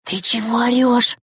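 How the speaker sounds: high, tense, voiced stage whisper; frightened whisper. A narrator's reading. Girl afraid the teacher will hear, frightened. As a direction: squeal it in alarm. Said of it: "испуганно вытрящив глаза, зашептала Тикаева".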